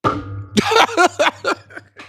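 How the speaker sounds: real laugh, loud and high, showing off the mic. Real, not acted. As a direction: let a big high laugh ring out. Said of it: "Listen to this shit".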